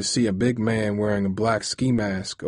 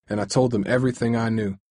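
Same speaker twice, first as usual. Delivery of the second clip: ordinary delivery, nothing marked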